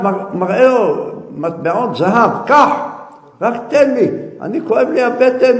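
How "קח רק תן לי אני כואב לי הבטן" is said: loud, high, urgent begging; desperate pleading. Acted. Sick man begging the seller for medicine, pleading. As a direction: beg for relief from the pain. Sufferer begs for medicine, offering gold.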